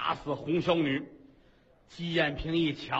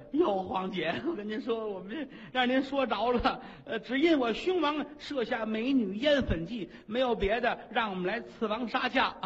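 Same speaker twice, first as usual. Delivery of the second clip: high, giggly court-lady voice, much higher; simpering confession; 5 semitones higher than usual. Acted. Consort confesses the plot to the queen, high and giggly. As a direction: giggle and beg as a silly consort.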